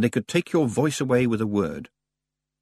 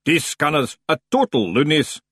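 higher Scots voice from below; new hidden voice; 6 semitones higher, 4 dB louder than usual. A narrator's reading. Hidden knee interrupting the borrower, higher and Scots. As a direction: pipe up as a second, hidden speaker. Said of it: "said a new voice".

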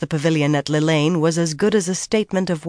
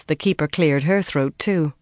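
ordinary delivery

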